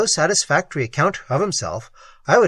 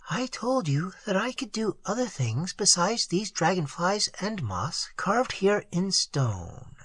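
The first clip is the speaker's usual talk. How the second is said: put-on gentle, hushed young woman's voice, softer; quiet, tender Isande; soft-spoken girl; 3 semitones higher, 8 dB quieter than usual. A narrator's reading. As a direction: speak to him softly, half shy.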